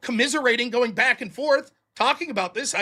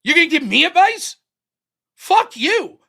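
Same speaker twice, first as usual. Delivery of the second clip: angry rant; 5 semitones higher, 5 dB louder than usual. Real, not acted. Ranting at the moderator.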